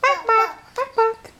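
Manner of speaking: high-pitched hen clucks; high, quick chicken imitation; chicken clucking. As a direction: squawk bawk-bawk like a hen. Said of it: "What does the chicken say".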